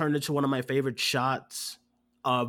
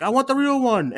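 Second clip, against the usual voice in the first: high, louder mock yell; heckler's shout; 9 semitones higher, 6 dB louder than usual. Imitating a theater heckler to co-hosts, high mock yell. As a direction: call out like a rude fan in the crowd. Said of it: "But then somebody was like".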